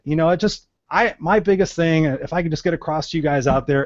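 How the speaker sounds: normal talking voice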